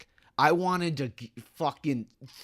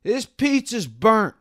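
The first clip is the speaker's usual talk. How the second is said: high, loud, slow complaint; whiny demanding customer; 10 semitones higher, 10 dB louder, slower than usual. Retold or imitated. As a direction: mimic a rude customer whining. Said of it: "she goes".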